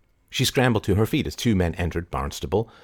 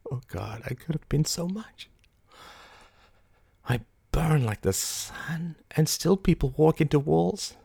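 high, very soft, near-whispered lament; crumpling despair; 7 semitones higher, 5 dB quieter than usual. A narrator's reading. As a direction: let the voice give way to despair. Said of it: "Her face crumpled".

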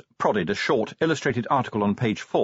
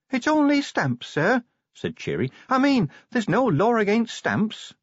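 put-on much higher, timid young dwarf voice; young dwarf Cheery; 13 semitones higher than usual. A narrator's reading. Cheery correcting Vimes about the stamps, timid and high. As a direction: correct the boss gently, pitched up high.

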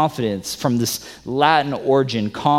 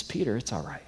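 gentle reassurance; 11 dB quieter, faster than usual. Acted. Jesus comforting Peter on beach.

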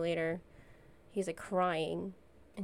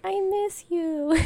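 put-on very high, drawn-out little-kid voice; imitating affectionate child; 12 semitones higher, 5 dB louder, much slower than usual. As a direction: stretch it out high and sweet, childlike. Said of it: "And I was like".

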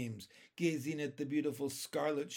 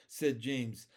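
ordinary delivery

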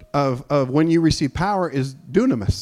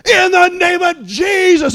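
slow, high, loud declaration; dramatic proclamation; 13 semitones higher, 6 dB louder, slower than usual. Retold or imitated. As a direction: call it out grandly. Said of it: "I stepped away and I said".

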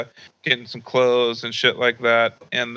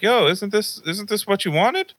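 higher, puzzled grandma asking; 9 semitones higher than usual. Retold or imitated. Grandma asks grandson about gift, higher puzzled voice. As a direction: pitch it up like a surprised grandmother.

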